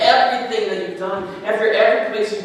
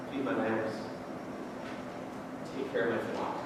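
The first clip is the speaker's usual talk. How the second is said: low and soft; soft commission; 6 semitones lower, 7 dB quieter than usual. Retold or imitated. Jesus to Peter, handing over the flock, low and soft. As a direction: entrust the flock gently.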